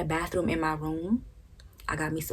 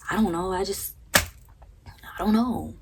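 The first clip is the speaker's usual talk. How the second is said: dismissive mom; 3 semitones higher, 4 dB louder, slower than usual. Retold or imitated. Imitating mom brushing it off.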